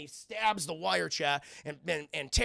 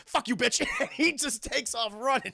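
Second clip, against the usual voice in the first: high, loud, laughing through it; 7 semitones higher, 4 dB louder than usual. Retold or imitated. Nelson ditches Blade and runs, told laughing. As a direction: crack up while retelling.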